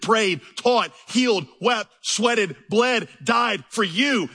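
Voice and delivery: fervent sermon list that climbs in pitch; building preaching litany. Real, not acted. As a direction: pile each word on, climbing toward the shout on bled. Building litany of Christ's suffering.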